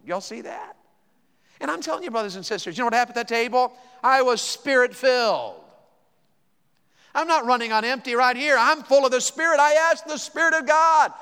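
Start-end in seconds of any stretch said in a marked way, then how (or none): 1.6-11.1 high, building pulpit proclamation; emphatic preaching. Real, not acted. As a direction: build up to a forceful testimony. Preaching at full force.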